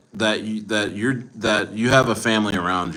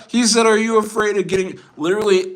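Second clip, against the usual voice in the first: high, laughing incredulous retelling; laughing while talking; 11 semitones higher, faster than usual. Real, not acted. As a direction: talk through laughter, pitched high. Laughing over commenter's question.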